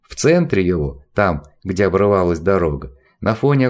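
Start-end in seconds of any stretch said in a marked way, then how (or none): none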